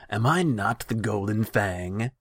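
loud, high imperious question; outraged royal bluster; indignant challenge. A narrator's reading. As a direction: pull rank on a subordinate. Khan indignantly challenges his advisor.

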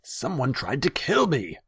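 high, loud panicked outburst; alarmed blurting. A narrator's reading. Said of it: "Tasigur blurted".

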